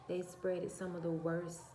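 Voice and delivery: ordinary talk, nothing marked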